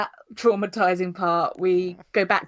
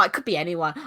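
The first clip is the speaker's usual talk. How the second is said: ordinary delivery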